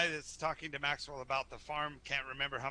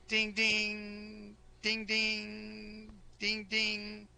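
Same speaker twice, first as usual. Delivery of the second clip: bell imitation; 7 semitones higher, much slower than usual. Driver rings wagon bell.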